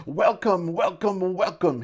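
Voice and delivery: high, effusive shopkeeper greeting; enthused welcome. A narrator's reading. Prosperous shopkeeper greeting new clients, enthused. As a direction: gush it, pitched well up. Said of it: "he enthused".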